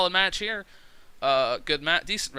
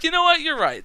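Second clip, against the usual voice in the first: higher, louder, playful concession; mock agreement; 13 semitones higher, 9 dB louder than usual. Conceding to commenters, playful high mock agreement. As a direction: give in with a grin you can hear. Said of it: "I was like".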